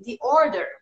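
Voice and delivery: ordinary talk, nothing marked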